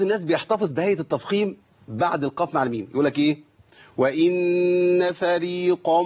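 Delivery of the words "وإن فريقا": وإن فريقا slow, qaf heaviness carried on; wrong heaviness. Shown as a demonstration. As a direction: drag the heavy qaf too far. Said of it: "إقام دي".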